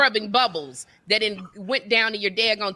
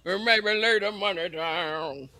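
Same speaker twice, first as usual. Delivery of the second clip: cross between [name] and [name]; 3 semitones lower than usual. Acted. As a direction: swallow the vowels like a caricatured singer, half sung. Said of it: "doing a cross between [name] and [name]".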